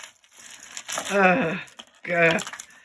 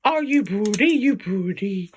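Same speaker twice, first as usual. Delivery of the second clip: put-on louder, slow baby talk to the dogs; asking the dogs; 2 semitones higher, 4 dB louder than usual. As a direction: coo the question at the dogs. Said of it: "I ask them".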